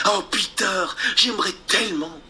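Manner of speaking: voix de fillette, plus aiguë, douce; rêver tout haut; fillette rêveuse. Acted. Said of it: "répondit Wendy". (Wendy rêveuse)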